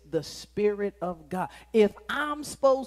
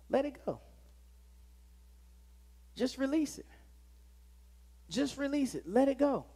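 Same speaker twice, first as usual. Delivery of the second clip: altar call appeal. Acted.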